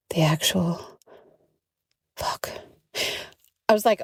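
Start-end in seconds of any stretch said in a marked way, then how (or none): 0.1-2.5 hushed stunned swear; breath of disbelief; disbelieving whisper. Retold or imitated. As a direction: whisper it, fading on fuck. Reacting in disbelief.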